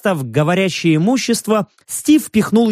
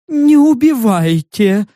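shy yelp; 6 semitones higher, 4 dB louder, slower than usual. A narrator's reading. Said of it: "застенчиво протявкал".

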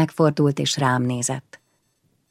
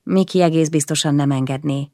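plain reading voice

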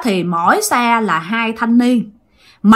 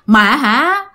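ordinary delivery, nothing marked

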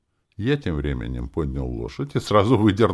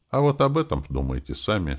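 higher-pitched, casual brush-off; offhand; 5 semitones higher than usual. A narrator's reading. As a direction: toss it off carelessly. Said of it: "сказал я небрежно".

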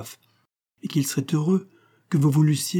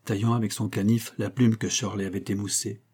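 ordinary delivery, nothing marked

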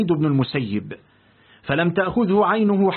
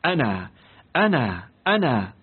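the sheikh's word still ringing for the student, low and distant; 5 semitones lower than usual. A narrator's reading. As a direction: let the word hum on in his ears. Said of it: "بهذا الصوت الذي لا يزال يطن".

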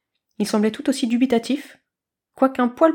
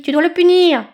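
much higher, loud squawk; shrieking eaglet; 7 semitones higher, 6 dB louder than usual. A narrator's reading. Eaglet shrieks at Blaireau about the snake. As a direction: scream the accusation. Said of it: "s'égosilla la friandise".

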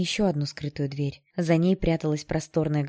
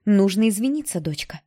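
louder, higher push, easing at the end; insistent; 4 semitones higher, 6 dB louder than usual. A narrator's reading. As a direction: press the request firmly. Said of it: "повторила мама настойчивее". (настойчиво, с нажимом)

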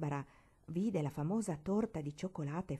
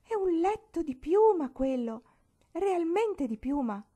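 much higher and louder, slowing in wonder; joyful exclamation; 11 semitones higher, 9 dB louder than usual. A narrator's reading. Barbara marvels at the feather bed, overjoyed. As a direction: gush with delight over it. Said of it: "esclamò gioconda la vivacissima Barbara".

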